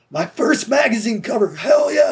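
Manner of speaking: high-pitched thrilled exclamation, mimicked; excited. Rock singer spotting his first magazine cover, excited. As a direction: act out a thrilled reaction, jumping up in pitch. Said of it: "He was all excited".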